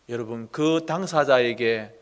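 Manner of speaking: normal talking voice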